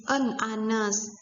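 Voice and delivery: "anes" with the H left silent. Shown as a demonstration. Teacher showing an example, silent H in "anes". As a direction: say "anes" starting straight on the vowel. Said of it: "karena H ini tidak dibaca".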